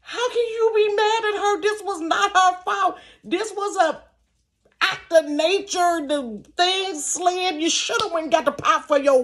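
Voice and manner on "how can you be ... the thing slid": very high, incredulous protest with laughter in it; retold indignation. Storyteller defending Tracy against daddy, indignant but amused. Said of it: "I was so pissed".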